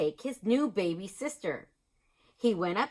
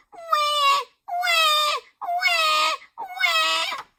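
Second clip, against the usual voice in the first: put-on high loud baby wailing; baby crying; 5 dB louder, much slower than usual. A narrator's reading. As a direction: wail louder, like a baby. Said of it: "baby ... said".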